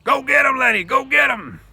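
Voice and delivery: very loud, high shout. Acted. George spurs Lenny on, very loud shout. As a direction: roar it out to spur him on. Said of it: "he shouted".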